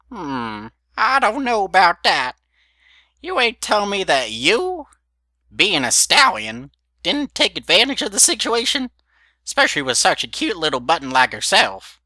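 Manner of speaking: put-on high, loud cackly old mare; old granny voice. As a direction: play a nosy old grandmother. Granny teasing about romance.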